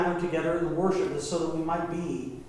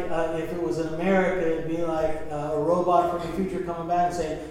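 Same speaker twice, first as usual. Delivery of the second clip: ordinary delivery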